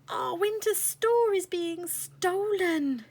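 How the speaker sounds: high, loud, slow alarm call; alarmed shout. Acted. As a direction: raise the alarm to the whole hall. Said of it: "he shouted".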